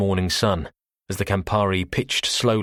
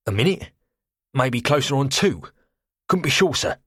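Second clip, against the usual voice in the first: put-on cockney accent; 6 semitones higher than usual. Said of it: "saturnine cockney".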